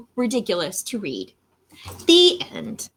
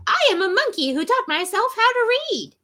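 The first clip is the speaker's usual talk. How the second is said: high, silly monkey voice; 9 semitones higher than usual. Acted. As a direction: read it as a goofy monkey. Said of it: "in my monkey voice".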